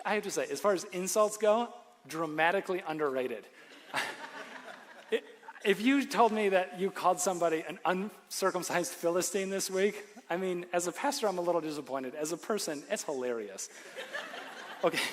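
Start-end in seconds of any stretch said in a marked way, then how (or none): 3.9-4.7 a quiet breathy laugh to himself; soft airy chuckle. Real, not acted. Amused by the insult. 13.7-14.8 quick high breathy giggle; high airy laugh. Real, not acted. Finds the insult hilarious.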